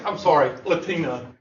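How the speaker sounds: ordinary delivery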